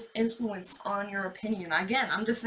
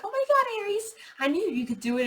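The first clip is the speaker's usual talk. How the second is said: high, loud gushing mock praise; fake congratulations; 11 semitones higher, 4 dB louder than usual. Doubters fake-praising Aries, in a high gushing mock voice. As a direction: gush like a two-faced friend.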